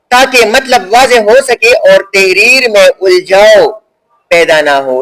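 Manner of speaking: slow, measured reading of a definition; formal dictation-style reading. Shown as a demonstration. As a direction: read it out slowly, phrase by phrase. Reads the definition on.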